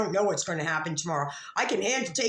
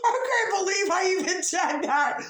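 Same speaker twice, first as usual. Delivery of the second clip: laughing; 12 semitones higher, 3 dB louder than usual. Real, not acted. Laughing at own goldfish story.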